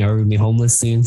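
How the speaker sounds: ordinary talk, nothing marked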